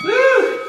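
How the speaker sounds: high cheerful whoop; excited whoop. Real, not acted. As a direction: whoop to pump up the class. Cheering the class on mid-workout.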